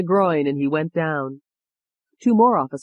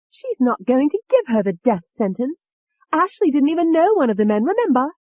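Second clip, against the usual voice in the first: high, loud contradiction; heated high-pitched insistence; defiant; 10 semitones higher, 4 dB louder than usual. A narrator's reading. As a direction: argue back in a raised, scornful pitch. Angry alter argues back.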